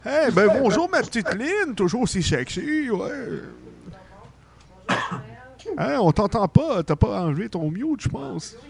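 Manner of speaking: lightly put-on, lilting Santa; jovial Santa Claus. Santa chats with callers.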